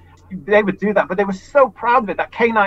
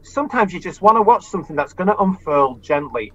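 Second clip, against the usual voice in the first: normal talking voice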